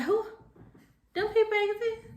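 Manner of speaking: put-on high voice of a puzzled kid; mock disbelief. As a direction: play a kid asking who a parent is.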